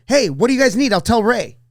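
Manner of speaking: lightly acted waiter calling out to customers; imitated eager waiter. Waiter offering regulars help, calling out as a mild imitation.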